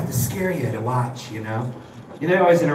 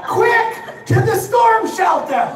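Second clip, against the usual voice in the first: hyped news alert; 11 semitones higher than usual. Retold or imitated. Comic to the crowd, frantic newscaster voice. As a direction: ham up a frantic weather alert. Mock TV weather alert bulletin.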